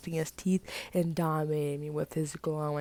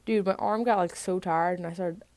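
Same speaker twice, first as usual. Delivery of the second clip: plain reading voice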